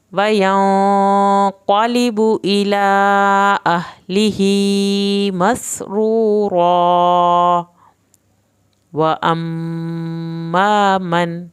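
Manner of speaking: loud, slow chanted recitation; Quranic recitation. Shown as a demonstration. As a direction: recite out full-voiced and melodic. Verse recited loudly as model.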